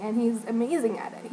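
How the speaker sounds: normal talking voice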